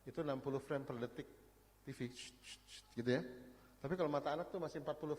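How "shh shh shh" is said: sharp whispered shh bursts; imitated TV flicker. Shown as a demonstration. Speaker showing TV frames rushing by, imitated hiss. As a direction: make quick hissing frame-flick noises.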